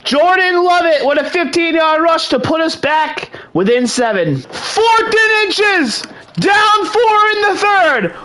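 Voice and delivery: high, shouted touchdown call with bursts of laughter; excited play-by-play. Real, not acted. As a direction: call the comeback live, thrilled, stretching big moments. Calls the game live.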